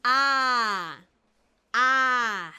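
loud eager A with mouth wide open; pupil repeating A. Acted. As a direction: copy the teacher's A with gusto. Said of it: "abriendo mucho la boca".

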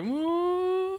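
high, drawn-out mock "ooh"; imagined intrigued reaction. Host imagines actor eyeing the couple, high mock-intrigued. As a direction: swoop up high, mock-tempted. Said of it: "did you kind of go".

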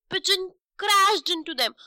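ordinary delivery, nothing marked

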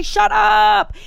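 high, loud shut up; exasperated shout. Retold or imitated. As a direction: snap it at the noisy parents. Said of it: "I just wanna say".

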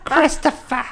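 very high wailing name call; shrill wail. Retold or imitated. Imitating the character's wail.

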